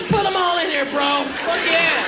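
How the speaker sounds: ordinary delivery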